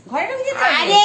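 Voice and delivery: very high, loud yell; scolding shout. Real, not acted. Owner to animal going into the house, scolding shout. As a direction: shout it high at a straying pet.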